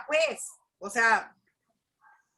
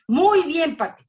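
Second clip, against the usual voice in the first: Muy bien, Pati said higher and louder, mock emphatic; imitating a pointed greeting; 6 semitones higher, 8 dB louder than usual. Retelling how the pastor's wife answered, mock emphatic greeting. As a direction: mimic her showy, pointed reply. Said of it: "Y yo vi que me hizo así".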